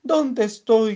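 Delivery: sehr hoch und laut gerufen; Schrei. Acted. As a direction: ruf verloren und verängstigt. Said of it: "gritó el pajarito".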